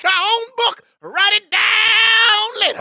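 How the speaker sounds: very high, slow preaching shout; preacher shout. Pretend. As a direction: belt it out like a revival preacher.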